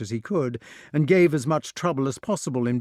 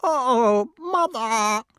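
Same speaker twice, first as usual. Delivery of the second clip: high, loud bird whine; whining crow; 12 semitones higher, 5 dB louder, much slower than usual. A narrator's reading. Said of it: "whined the crow".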